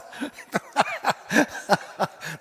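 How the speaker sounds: amused burst of laughter; delighted laugh. Real, not acted. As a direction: laugh out loud at the coincidence. Reacts to son named Jonah.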